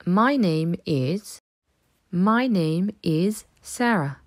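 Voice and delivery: slow, careful "My name is" for repeating; slow model answer. Shown as a demonstration. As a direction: model it slowly for the class. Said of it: "Tu répondras alors". (réponse modèle lente)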